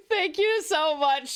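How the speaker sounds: ordinary talk, nothing marked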